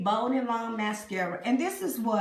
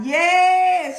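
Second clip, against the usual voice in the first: excited yeah; 9 semitones higher, 13 dB louder than usual. Real, not acted. Excited reaction to her look.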